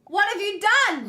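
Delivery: high, loud cry of dismay; shrieking at the ruined desk; shocked shout. Acted. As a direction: yell it in horror.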